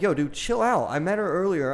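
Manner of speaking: high, urgent pleading; defensive protest. Retold or imitated. Defending himself to an angry stranger at a bar.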